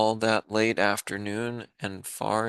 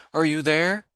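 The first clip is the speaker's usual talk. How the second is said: louder, higher call; 6 semitones higher, 5 dB louder than usual. Acted. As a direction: call out searching through the clover.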